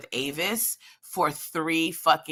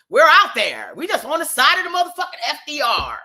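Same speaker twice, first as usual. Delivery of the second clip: usual speaking voice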